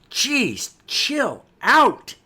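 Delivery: loud, high-pitched outburst; exasperated shout. Acted. Young hero fed up with his stern partner, exasperated yell. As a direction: burst out, each word punched and falling. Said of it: "Then Jack goes".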